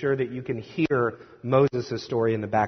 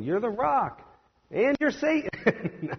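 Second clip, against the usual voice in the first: higher, playful singsong, last words stretched slowly; mock singsong; 11 semitones higher, slower than usual. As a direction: tease the swing from rock to Satan. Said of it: "the faith of Peter kind of going".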